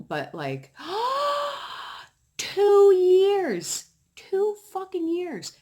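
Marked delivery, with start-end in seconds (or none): 0.8-2.0 gasp. Real, not acted. Outrage at the lie. 2.4-5.5 very high, loud outraged cry, slowing down; outraged exclaim. Real, not acted. Venting to viewers about the neighbor's lie, high loud outrage. Outraged at two years of lying.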